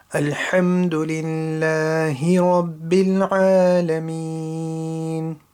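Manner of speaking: measured, melodic Quranic recitation. Shown as a demonstration. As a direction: recite it with full tajweed, letting each phrase ring. Slow tajweed demo of ayah.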